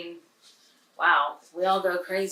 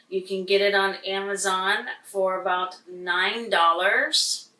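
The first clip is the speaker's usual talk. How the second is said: usual speaking voice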